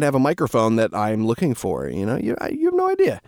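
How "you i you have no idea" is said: squeaky high exclamation. Real, not acted.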